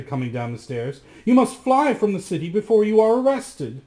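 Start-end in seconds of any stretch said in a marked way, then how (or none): 1.2-3.8 urgent. Acted. Giulietta urges him to flee.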